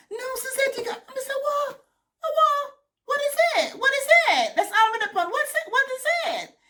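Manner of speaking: high-pitched, puzzled repeated what; mock bewildered, high and loud; reenacted confusion. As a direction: squeal out the confused questions. Retelling a confused exchange, high.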